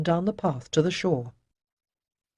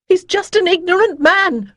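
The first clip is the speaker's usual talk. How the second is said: much higher, loud pleading cry; shriek; 14 semitones higher, 9 dB louder than usual. Acted. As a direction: scream the plea. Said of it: "Demisa shrieked".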